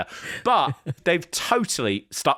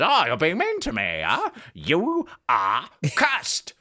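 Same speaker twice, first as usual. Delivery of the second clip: mock-wounded complaint turning into a slow, solemn curse; Danhausen. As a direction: imitate a petty curse, dragging out the last words. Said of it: "Danhausen just says".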